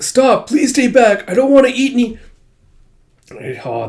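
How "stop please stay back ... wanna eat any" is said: frightened pleading. Retold or imitated. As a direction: beg him to keep away. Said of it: "She's like".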